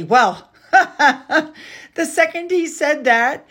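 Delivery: slow, laughing through the words; real amused laugh breaking in. Real, not acted. Laughing at Morgan's comeback.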